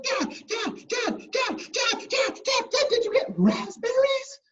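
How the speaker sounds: put-on very high, rapid-fire kid squeal; so excited girl. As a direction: pester Dad in breathless excitement. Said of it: "she was so excited".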